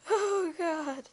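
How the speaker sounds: a mock scream of horror; screaming bloody murder. Shown as a demonstration. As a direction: shriek it in mock terror. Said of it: "let's see if I can get my expression right".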